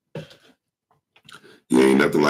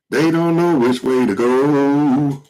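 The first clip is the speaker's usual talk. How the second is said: high, slow crooning imitation; 10 semitones higher, 3 dB louder, slower than usual. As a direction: croon it like a soul singer. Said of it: "say it like [name] just said".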